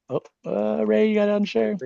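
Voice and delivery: aside to Ray. Real, not acted. Aside to participant on Zoom call.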